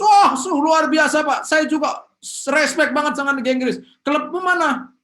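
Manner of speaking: fake enthusiasm. Faking enthusiasm about football.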